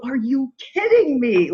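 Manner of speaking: high, slow incredulous "kidding"; disbelief. Retold or imitated. Stunned by her acceptance, high slow disbelief. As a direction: stretch it out in amazed disbelief.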